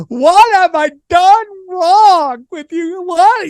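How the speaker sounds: high, slow, shrieking mock mom; mother wailing at her son over pizza; mom freaking out. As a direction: ham up a horrified mother. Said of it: "you're like".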